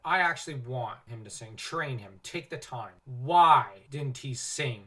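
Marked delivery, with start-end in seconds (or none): 3.1-4.8 slow, loud exasperated question; exasperated demand. Real, not acted. Reviewer fed up that Aragorn's song was cut, exasperated. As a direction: drag it out, fed up. Complaining about missing song.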